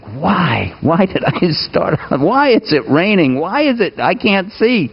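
rising pitched, pleading questions to the sky; exasperated whining. Retold or imitated. Frustrated driver in blinding rain.

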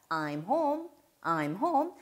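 model phrase for repeating. Shown as a demonstration. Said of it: "이끌어 주셔야 됩니다".

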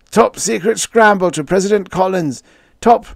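high, urgent panicked call; desperate shout. A narrator's reading. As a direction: shout a desperate message into the transmitter. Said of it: "shouted into the sender".

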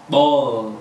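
ball ending on a dark L held with the tongue on the ridge. Shown as a demonstration. Teacher demonstrating the held dark L in ball. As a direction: hold the final L in ball, don't drop it. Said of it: "chẳng hạn như từ".

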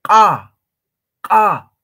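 loud KA popped hard from the back of the mouth; popping glottalized Q. Shown as a demonstration. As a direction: open wide and explode KA. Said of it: "Q con su apóstrofo".

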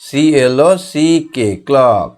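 slow, letter-by-letter spelling. Shown as a demonstration. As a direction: spell each letter clearly for the class. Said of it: "এটা দেখো তো clock". (ধীরে ধীরে বানান বলা)

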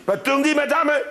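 ordinary talk

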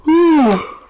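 normal talking voice

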